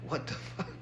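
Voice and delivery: very soft, stunned aside with a laugh; mock disbelief under the breath, breaking into laughter; retold shock. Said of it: "I was shocked".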